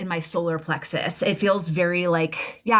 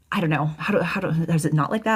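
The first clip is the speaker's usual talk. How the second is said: ordinary talk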